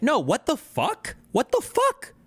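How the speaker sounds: rising in disbelief, pitch jumping high on the second; incredulous outburst. Real, not acted. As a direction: cry out what the fuck as if you can't believe it. Outraged reply to pundit framing.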